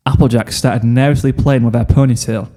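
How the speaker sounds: ordinary delivery, nothing marked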